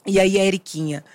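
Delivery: normal talking voice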